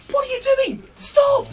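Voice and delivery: high-pitched acted alarm; appalled protest. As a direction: squeal it as a shocked objection. Shocked salesman confronts man in joke.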